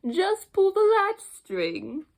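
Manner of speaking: frail grandmother. Acted. Grandmother inviting the visitor in, slow and high. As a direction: coax gently like an old woman.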